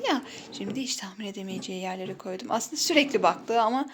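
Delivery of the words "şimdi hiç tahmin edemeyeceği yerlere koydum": unvoiced whisper aside. Real, not acted. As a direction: whisper it behind her back. Secret hint kept from child.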